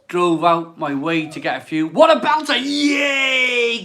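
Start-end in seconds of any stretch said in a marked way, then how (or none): 1.9-3.8 high, loud cheer of delight; excited shout. Real, not acted. Cheering a viewer who bought the beer, loud and high. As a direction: whoop with real joy.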